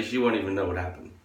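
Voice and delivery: usual speaking voice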